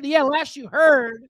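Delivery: smug uncle mocking the cousin at dinner; taunting rich uncle. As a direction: rub the kid's failures in. Uncle Jerry taunting the kids.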